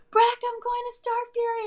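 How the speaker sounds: high, excited news to a friend; excited squeal. Retold or imitated. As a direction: blurt it out, thrilled and high. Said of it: "I was like".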